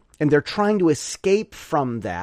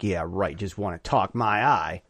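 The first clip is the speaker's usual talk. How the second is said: sarcastic; 8 semitones lower, 5 dB quieter than usual. Retold or imitated. Sarcastic reply to the police.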